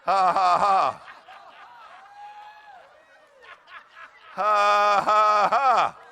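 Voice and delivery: loud, measured "ha, ha, ha"; deliberate laugh. Shown as a demonstration. Preacher showing the congregation how to practise laughing, deliberate laugh. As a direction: force out each ha loudly. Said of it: "Practice laughing for a few minutes".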